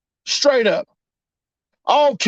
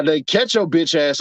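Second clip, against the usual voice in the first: usual speaking voice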